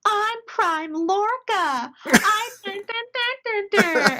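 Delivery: high, drawn-out mock-dramatic announcement; Prime Lorca impression. Fan copying an actor's party-trick impression, hammed up. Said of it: "He has this hilarious impre-, impression".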